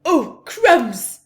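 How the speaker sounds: a robber startled by a howl. A narrator's reading. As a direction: jump with fright. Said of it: "said Len".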